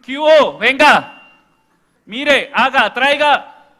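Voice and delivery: loud, high yelled commands; barked orders. Retold or imitated. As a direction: shout orders at the family. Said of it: "el lenguaje era el grito".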